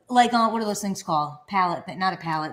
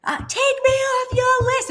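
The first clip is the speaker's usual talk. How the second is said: much higher, slow mock yell; retold scream. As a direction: yell it at the caller, half-hammed. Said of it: "screaming at them".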